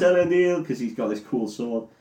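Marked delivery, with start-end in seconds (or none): 0.0-0.6 retold battle cry, high and loud; raised, high-pitched mock shout. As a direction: call it out like a mock war cry. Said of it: "he shouts".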